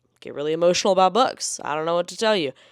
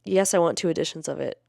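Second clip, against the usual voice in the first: normal talking voice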